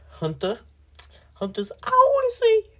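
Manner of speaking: very high, slow put-on squeak; weird voice. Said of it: "I gotta stop doing weird voices".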